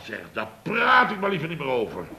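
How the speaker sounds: normal talking voice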